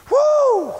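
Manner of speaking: high whooping cheer; preacher whooping for joy; joyful woo. Real, not acted. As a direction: let out a big happy whoop. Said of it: "pastor cheers".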